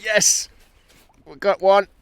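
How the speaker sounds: high, loud burst of triumph; real excited cry. Real, not acted. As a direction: cheer the catch, pitched well up. Angler hooks a fish.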